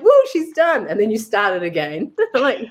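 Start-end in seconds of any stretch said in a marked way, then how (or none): 0.0-0.9 high teasing whoop; mock cheer. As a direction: cheer her on, half joking. Friends mock-cheering from sidelines.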